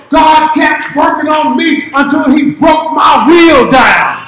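loud, rising preacher's cry; preaching at full force. Acted. Preacher testifying to the church, full force. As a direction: drive it home to the congregation.

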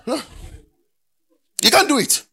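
loud, high, driving sermon delivery; shouted, rousing preacher's rant; full-force preaching. Real, not acted. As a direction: preach it hard to a whole hall.

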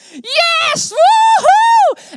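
uitbundig gejuich, veel hoger en harder; juichend. Retold or imitated. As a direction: juich het uit als een gek van vreugde. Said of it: "en die begon te juichen". (luid en hoog juichen)

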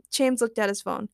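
usual speaking voice

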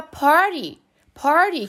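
"party" with the T tapped like an R. Shown as a demonstration. Teacher presenting the example word, T as R. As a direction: say "party" American style, tongue flick for T. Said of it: "eu pronuncio o T com som de R".

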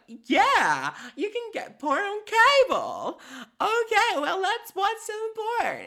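very high, loud squeaky cartoon voice; squeaky vagina character. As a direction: play the puppet girlfriend, giddy and shrill-high. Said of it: "vagina's like".

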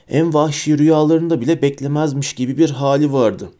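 ordinary delivery, nothing marked

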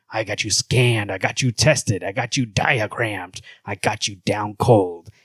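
low, slow boasting hero voice; Batman. Acted. Host boasting to Superman, slow low Batman voice. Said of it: "low voice for Batman".

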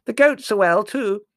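loud, high oblivious interjection; booming high aunt voice; hard-of-hearing aunt. A narrator's reading. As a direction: chime in loudly as if deaf. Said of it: "said Aunt Fan".

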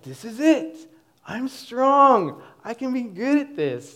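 high, loud and fired up, convincing himself; pumped-up inner voice. Retold or imitated. Teen wrestler psyching himself up, high and loud. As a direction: talk yourself into believing you're strong.

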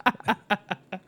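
high laugh. Real, not acted. Laughing at less offensive.

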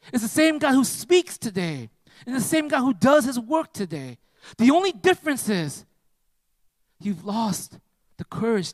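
high, driving sermon delivery; impassioned preaching. Real, not acted. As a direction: exhort the congregation with forceful emphasis. Preaches with force to congregation.

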